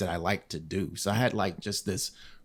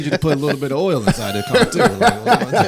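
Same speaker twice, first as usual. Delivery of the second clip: loud laughing plea as the body; louder, high, laughing through the words; 6 semitones higher, 10 dB louder than usual. Retold or imitated. Man voicing his body asking for care, laughing loudly.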